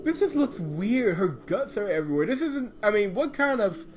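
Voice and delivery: high-pitched laughing protest; laughing disbelief. Real, not acted. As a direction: squeal through laughter. Cracking up at the scene.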